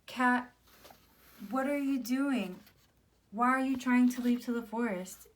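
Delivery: mild scolding. Acted. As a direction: challenge her, mildly alarmed. Sister challenges the runaway mouse.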